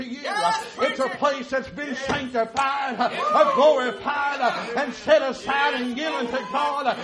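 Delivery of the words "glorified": normal talking voice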